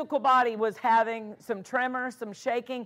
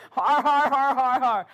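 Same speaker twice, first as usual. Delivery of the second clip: big, loud har-har laugh; exaggerated mimicked guffaw, every har punched; Brother Hagin's laugh; 7 dB louder than usual. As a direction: imitate a preacher's booming belly laugh. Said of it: "And you hear Brother Hagin".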